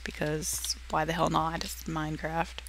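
whispered aside. Real, not acted. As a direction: drop to a whisper. Whispered aside while playing.

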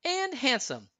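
clean, high heroine voice, no creature sound; Anna agreeing. A narrator's reading. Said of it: "Anna agreed".

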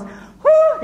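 high, loud whooping call; crowd's hoo call. Retold or imitated. Villagers calling out a welcome, high loud whoop. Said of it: "Half your crowd goes".

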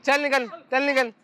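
high, brushing-off orders; scornful dismissal. Acted. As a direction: wave them away with contempt. Dismissing the visitors.

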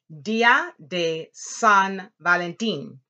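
DÍA and VALENTÍN stressed on the accented í, slow and loud. Shown as a demonstration. Teacher modelling the holiday's name for class, accents stressed. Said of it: "your voice goes up".

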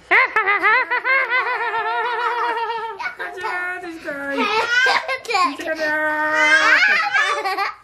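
squealing laughter while tickled; helpless ticklish giggles; being tickled. Real, not acted.

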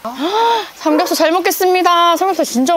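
high, loud delight at food; thrilled at the sizzling pork; excited. Real, not acted. Excited at grilled pork belly.

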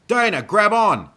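very high, loud rescue call; urgent shout. A narrator's reading. Sebastian rides through to pick up Dana, urgent shout. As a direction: shout to someone you are racing past.

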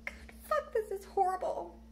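breaking into sobs, cursing under breath; tears taking over, words half whispered; crying. Real, not acted. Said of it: "I get emotional".

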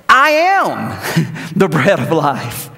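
drawn-out, weary emphasis on each word; a hint of exasperation; exasperated inflection. Shown as a demonstration. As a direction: say it like he's tired of repeating himself. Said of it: "I just read that with that kind of inflection".

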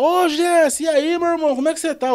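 high-pitched, hearty imitation of a friend's hello; imitating the gatekeeper's upbeat greeting; Roberval's greeting. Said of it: "Aí o cara, o Roberval".

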